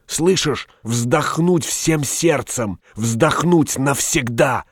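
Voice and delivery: high, strained, impassioned plea; frenzied fervour. A narrator's reading. Shopkeeper explaining a dying man's wish, frenzied fervour. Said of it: "в выступлении шептал Стамодор".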